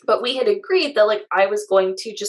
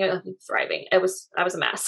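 ordinary talk

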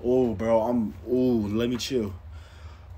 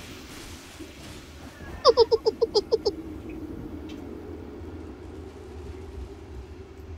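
breathless laugh. Real, not acted. Laughs after raging.

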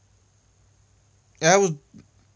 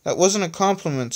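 higher, mildly cross protest; angry; 6 semitones higher than usual. A narrator's reading. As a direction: object with a touch of anger. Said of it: "said Mirak angrily".

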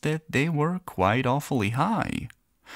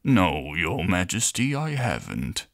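much lower glum minister voice; low sour minister; 6 semitones lower than usual. Acted. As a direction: sour courtier, low and dry. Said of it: "answered the minister".

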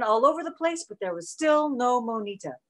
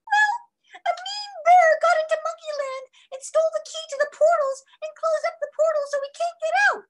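very high squeaky toy monkey; 3 dB louder than usual. A narrator's reading. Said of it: "she said in a squeaky voice".

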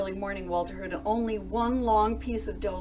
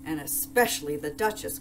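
ordinary delivery

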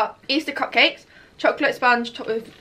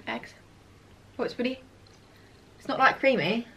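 talking through a bite of chocolate; chewing between words; mouth full, chewing; 6 dB quieter, faster than usual. Real, not acted. Seen 1: Left woman bites chocolate egg, speaks chewing with mouth full.

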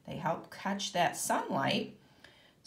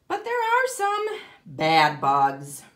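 louder, dramatic announcement; dramatic warning; 9 dB louder than usual. Acted. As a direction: announce the bad guys with a louder voice. Teacher dramatizes bad bugs.

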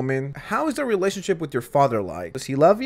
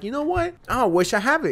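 mock wishful girl; 8 semitones higher than usual. Mocking girls who want illness.

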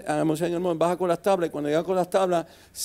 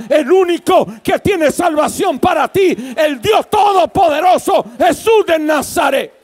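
builds to a loud, high shout; preaching at full force; 7 semitones higher, 9 dB louder than usual. Acted. Pastor calls the congregation to the living God, shouting. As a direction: cry it out over the whole church.

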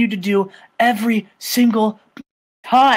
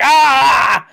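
loud, high panicked yelp; a sharp scream as the arm bar bites; freaked-out yelp; 6 semitones higher, 8 dB louder than usual. Retold or imitated. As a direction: cry out in sudden panic. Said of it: "he's freaking out".

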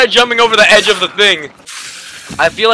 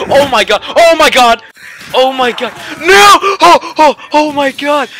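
panicked shouting; 6 semitones higher than usual. Real, not acted. Overwhelmed by zombies.

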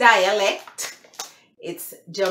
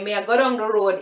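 Jamaican patois with "down" like "dong"; faster than usual. Shown as a demonstration. Vlogger naming the broken English phrase, Jamaican patois. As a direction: run it together as Jamaican patois. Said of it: "is the broken English, patois".

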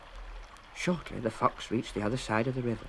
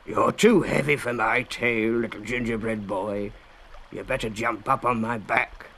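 growled offer; 4 dB louder than usual. A narrator's reading.

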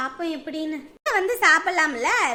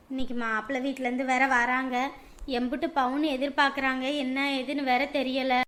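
ordinary talk

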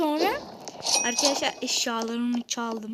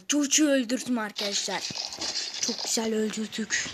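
ordinary delivery

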